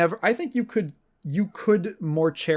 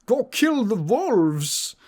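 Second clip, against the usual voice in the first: high, slow, overblown quest-giver voice; quest-giver NPC; 6 semitones higher, slower than usual. Retold or imitated. Quest-giver begging the player to kill wolves, hammed-up high and slow. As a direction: drag out the urgent request like a cartoon. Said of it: "who you walk up to and they go".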